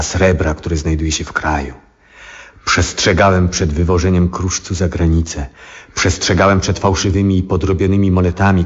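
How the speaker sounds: normal talking voice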